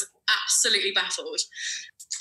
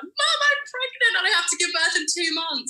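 retold scream; 10 semitones higher than usual. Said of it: "I ended up screaming down the phone to her".

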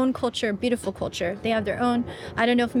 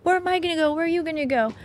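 put-on curious kid voice; 7 semitones higher, faster than usual. Her child self asking dad.

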